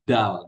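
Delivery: "dal" said thick and full like a plain D; heavy Betawi dal. Shown as a demonstration. Teacher showing the Betawi way of saying dal, thick and heavy. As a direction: make "dal" thick and big. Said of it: "persis sama dengan D".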